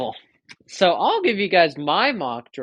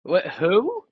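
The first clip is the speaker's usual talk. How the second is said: high, bewildered who; genuinely confused question; baffled; 9 semitones higher, 3 dB louder, slower than usual. Real, not acted. Confused by unknown pick.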